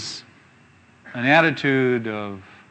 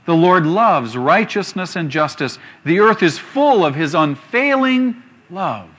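slow, louder reading of the verse; scripture reading; 3 semitones higher, 5 dB louder than usual. Acted. Preacher reads a psalm to the congregation, slow and solemn. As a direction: read the verse out slowly with weight.

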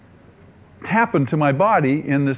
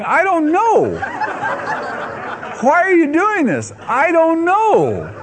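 giddy helpless confusion; high laughing stretched "I don't know"; laughing bewilderment; 14 semitones higher, much slower than usual. Retold or imitated. Inner dialogue, mystified and laughing.

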